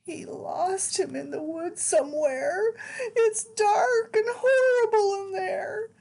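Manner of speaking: put-on lively read-aloud for small children; animated picture-book narration; storybook reading. A narrator's reading. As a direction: read it like bedtime story time.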